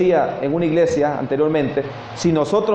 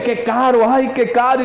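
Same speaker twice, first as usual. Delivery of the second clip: higher-pitched fretting over the cost; put-on lament, pitched up; mock lamenting woman; 5 semitones higher than usual. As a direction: imitate a woman bemoaning her loss. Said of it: "Pero no era por el perfume".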